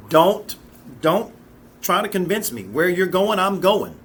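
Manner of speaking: begging. Retold or imitated. Ruth begging Naomi to let her stay, loud pleading. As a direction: plead with her not to send you away. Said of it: "She begs her mother-in-law".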